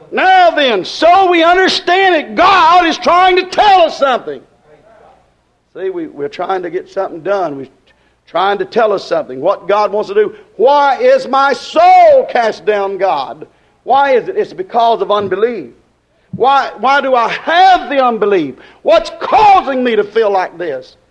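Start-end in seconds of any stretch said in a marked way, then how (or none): 0.1-4.4 high, ringing pulpit proclamation; sustained high revival delivery; full-force preaching. Acted. Preaches to congregation. 10.6-21.0 emphatic pulpit questioning; anguished sermon lament; impassioned preaching. Acted.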